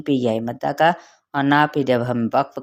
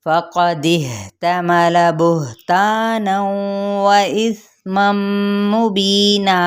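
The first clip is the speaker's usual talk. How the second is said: slow, melodic chanted recitation, higher than speech; Quranic recitation; 6 semitones higher, slower than usual. Shown as a demonstration. Teacher reciting the verse for students, in slow melodic chant. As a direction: draw out each vowel in a measured tajweed chant.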